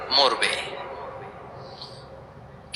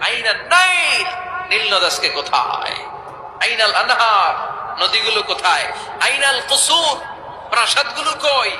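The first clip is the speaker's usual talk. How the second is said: rising fiery preaching; 4 semitones higher than usual. Acted. God questions the earth, building to a shout. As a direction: climb in force with each question.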